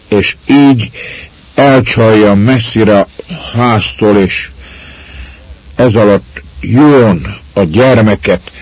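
halting, frightened stammer with repeats; the cowering lad confessing the theft. A narrator's reading. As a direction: stumble over each word, cowed. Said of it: "és dadogni kezdett".

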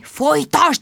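high, voiced hiss to go on; hissed urging. A narrator's reading. Sharon egging Bridget on again, hissing. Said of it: "sziszegte Sharon".

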